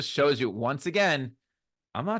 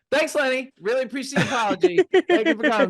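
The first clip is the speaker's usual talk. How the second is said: high, loud, over-bright thanks; hurried polite wrap-up; 11 semitones higher, 6 dB louder, faster than usual. Retold or imitated. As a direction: rush the thanks to end an awkward moment. Director hastily ends an awkward silence.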